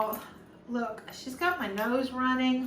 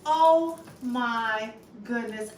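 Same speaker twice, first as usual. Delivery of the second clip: usual speaking voice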